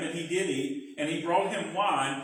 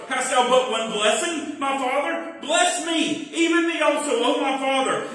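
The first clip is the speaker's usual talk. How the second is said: higher, louder pleading; weeping plea; 5 semitones higher, 4 dB louder than usual. Acted. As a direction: lift the voice in tears. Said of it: "Esau lifted up his voice and wept".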